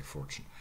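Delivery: "fortune" as FOR-chun, r sounded; soft American "fortune". Shown as a demonstration. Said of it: "Americans usually say".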